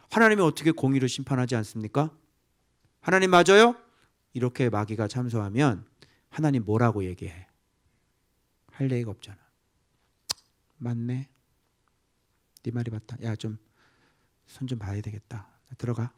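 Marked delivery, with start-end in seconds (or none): 3.0-3.8 loud mock challenge. Devil pressing God. 10.8-16.1 softer, resigned giving in; quiet resigned agreement. Retold or imitated. God quietly conceding to the devil, resigned.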